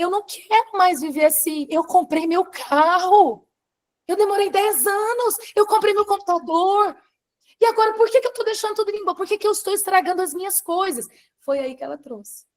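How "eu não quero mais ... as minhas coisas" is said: high-pitched, distressed protest; reenacted crisis outburst. Woman retelling her crisis over lost belongings, distressed high voice. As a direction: replay a meltdown, voice pushed up high. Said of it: "Aí me deu uma crise".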